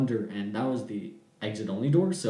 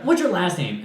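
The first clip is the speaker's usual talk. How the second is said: high, louder demand; 6 semitones higher, 6 dB louder than usual. Retold or imitated. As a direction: press him for his name. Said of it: "that set her off again".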